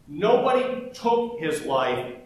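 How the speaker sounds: usual speaking voice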